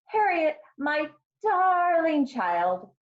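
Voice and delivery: high, drawn-out motherly plea; held-in exasperation. A narrator's reading. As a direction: strain to stay sweet, stretching "darling". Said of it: "Her mother didn't like to yell".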